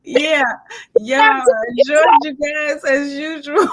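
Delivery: real laughter while trying to sign off; laughing. Real, not acted. Host thanking the guests, laughing hard.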